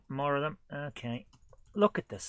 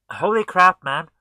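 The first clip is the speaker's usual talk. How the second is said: much louder stunned cry; louder shock; 5 semitones higher, 11 dB louder than usual. Real, not acted. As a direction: yell it in disbelief. Repeated exclamation at game.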